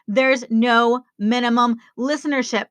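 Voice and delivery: ordinary talk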